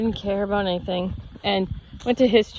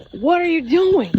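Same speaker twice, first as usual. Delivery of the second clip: louder, higher scolding question; scolding teacher; 8 semitones higher, 5 dB louder than usual. Retold or imitated. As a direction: bark it like an annoyed teacher. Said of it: "And he goes".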